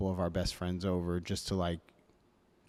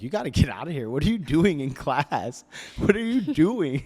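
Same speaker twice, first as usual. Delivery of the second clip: higher and louder, rising to a laugh at the end; urgent teacher; 7 semitones higher, 8 dB louder than usual. Retold or imitated. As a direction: push the words out in alarmed disbelief, then a laugh breaks through. Teacher urging crying student out.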